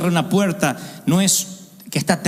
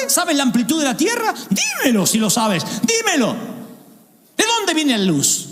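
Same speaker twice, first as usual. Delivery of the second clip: high, shouted divine challenge; voice of God; 6 semitones higher than usual. Acted. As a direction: thunder the questions at him. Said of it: "Y Dios sigue".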